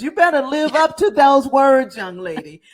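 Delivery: playful warning, starting high and loud; mock scolding. Host teasing guest after a glowing introduction, mock scolding. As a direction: pretend to tell off a young lady, then drop.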